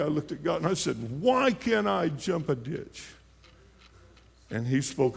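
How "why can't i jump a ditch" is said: high and slow, accusing the sky; angry complaint. Retold or imitated. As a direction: drag out the grievance to God. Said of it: "you know, in an angry way".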